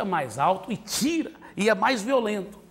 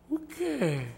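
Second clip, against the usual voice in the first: higher, soft innocent question; child playing dumb; 8 semitones higher, 7 dB quieter than usual. Son pretending he forgot the rule, mock innocent. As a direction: pretend not to know.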